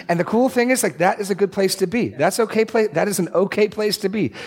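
light up with delight at the point; excited. Real, not acted. Preacher telling listeners confusion is fine, high and excited. Excited aside about faith.

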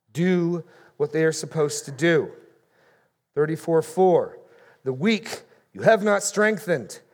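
loud, ringing pulpit reading; booming sermon scripture reading; preaching at full force. Acted. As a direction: thunder out the prophet's rebuke. Forceful reading of Ezekiel rebuke.

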